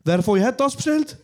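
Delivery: mock loud call to the flatmates; loud question. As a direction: call out to the whole flat as if the order were not his own. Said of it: "ich so laut".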